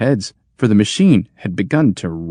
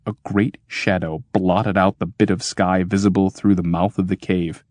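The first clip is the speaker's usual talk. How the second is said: ordinary delivery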